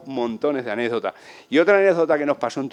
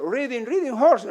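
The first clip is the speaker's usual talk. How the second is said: put-on eager kid's broken English; 11 semitones higher than usual.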